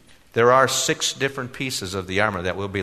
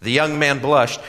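ordinary delivery

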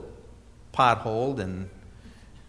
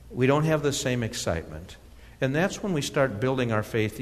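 ordinary talk

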